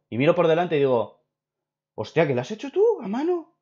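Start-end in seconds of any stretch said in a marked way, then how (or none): 2.0-3.6 high-pitched, incredulous astonishment; stunned, voice shooting up; amazed question. Retold or imitated. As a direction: act out disbelief at a handmade gift. Acting out amazement at handmade gift.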